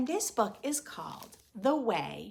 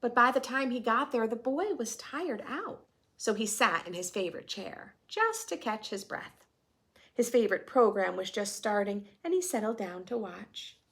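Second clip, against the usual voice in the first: storytelling voice; 3 semitones higher, 4 dB louder than usual. A narrator's reading. Reading picture book to class.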